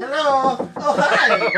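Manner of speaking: big loud hello, laughing as you say it; loud laughing greeting. Acted. Neighbor bursting in on the child with a loud, laughing hello.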